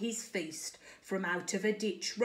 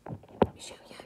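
soft secret aside; hushed whisper; 3 dB quieter, faster than usual. Real, not acted.

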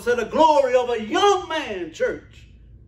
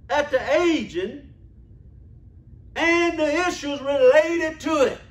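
usual speaking voice

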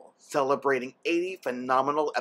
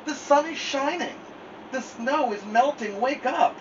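high eager calling; excited; 12 semitones higher than usual. Acted. Frog telling Toad snow is melting, excited. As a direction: announce the sunshine with glee.

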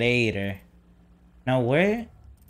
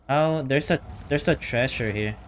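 usual speaking voice

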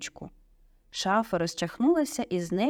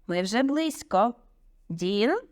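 moderate raised teacher's call; shouting; 3 semitones higher, slower than usual. A narrator's reading. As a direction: urge the class on with a raised voice. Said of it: "закричав Люпин".

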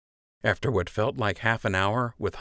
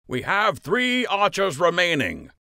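raised announcer's call, higher; Master Fletcher calling out; 11 semitones higher than usual. A narrator's reading. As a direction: call it out to the crowd like a tournament master. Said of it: "Master Fletcher called".

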